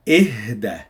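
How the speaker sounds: "إهدى" with a strong breathy h before "da"; "إهدى" as a low falling command with audible H; stressed H. Shown as a demonstration. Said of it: "you stress on the H".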